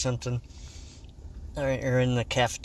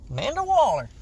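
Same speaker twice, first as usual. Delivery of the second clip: raised, pitched-up repeat; high silly voice; 11 semitones higher, 5 dB louder, faster than usual. Acted. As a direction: say the name in a silly voice. Repeating name, voice far.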